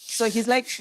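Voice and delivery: ordinary delivery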